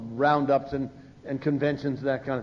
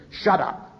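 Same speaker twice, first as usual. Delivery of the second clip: sponsor's curt retort; 3 semitones higher than usual. Retold or imitated. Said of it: "the nicest thing he said the first few years was".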